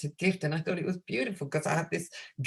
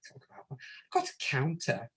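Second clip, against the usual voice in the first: whisper; 7 dB quieter than usual. Retold or imitated. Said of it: "thinking to herself".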